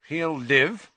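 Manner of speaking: grim. A narrator's reading. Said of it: "said Madam Pumphrey grimly".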